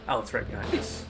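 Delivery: usual speaking voice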